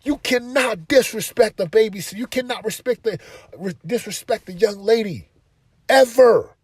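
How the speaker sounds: high, loud stern warning to son; stern scolding. Retold or imitated. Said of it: "I'm like".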